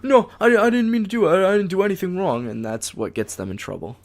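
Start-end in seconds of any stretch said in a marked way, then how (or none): 0.0-2.5 put-on high pleading kid voice; whiny kid. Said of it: "Most kids go".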